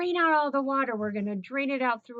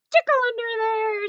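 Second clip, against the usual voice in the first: much higher, louder tickle squeal; playful squeal; 13 semitones higher, 8 dB louder than usual. Acted. Teacher squealing the tickle line to the children. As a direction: burst up high for the tickle.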